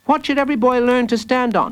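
put-on high, quick little boy; child's voice. A narrator's reading. Jacob quizzes the villain, high quick child voice. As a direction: pipe it up high and fast.

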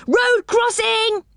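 high, stretched-out warning call; crying out. A narrator's reading. Rick on lookout warns Joe, crying out. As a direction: sing out a high, urgent warning. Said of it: "he cried".

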